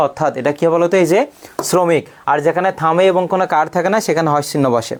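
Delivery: ordinary delivery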